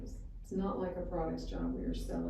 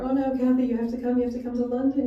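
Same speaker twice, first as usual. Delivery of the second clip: pleading caller; 5 semitones higher, 9 dB louder than usual. Retold or imitated. Callers beg her to come.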